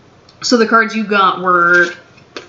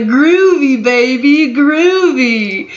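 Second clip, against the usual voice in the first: higher, louder swinging sixties spy impression; Austin Powers; 6 semitones higher, 5 dB louder, slower than usual. Tarot reader riffing on her own vibe for viewers, hammed-up higher louder spy impression. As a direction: ham it up like a groovy British spy, higher and louder. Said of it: "Austin Powers right now".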